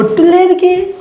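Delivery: high-pitched, exasperated objection; indignant refusal. Real, not acted. Boyfriend balks at swearing an oath, indignant.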